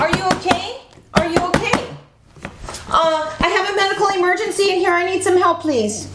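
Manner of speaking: high, urgent call for help. Acted. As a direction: call out to a collapsed stranger. Calling for help, acted emergency.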